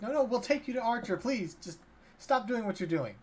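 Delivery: high-pitched, acted panic; panicked pleading. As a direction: beg them urgently to stop. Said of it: "the people kind of panic".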